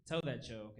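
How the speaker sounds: ordinary talk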